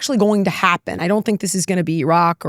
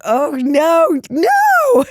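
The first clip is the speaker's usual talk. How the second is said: sudden high squeal of dismay, getting louder; a mock-horrified yelp as if watching a mistake; 10 semitones higher, 4 dB louder, much slower than usual. Reacting to the president's moves with a high, dismayed oh no. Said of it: "Does he do stuff I'm like".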